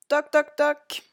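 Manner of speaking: high, loud spoken knocks; knocking sound. A narrator's reading. Narrator imitates knocking at the caravan door, high and punchy. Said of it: "on frappa à la porte".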